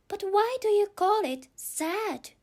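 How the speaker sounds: put-on girlish, curious and high; a young girl asking, puzzled. Alice puzzled question.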